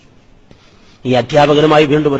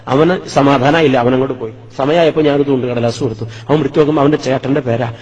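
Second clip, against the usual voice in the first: ordinary delivery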